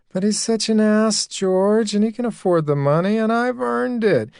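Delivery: lightly voiced woman justifying herself; Connie's voice. Connie defends taking money.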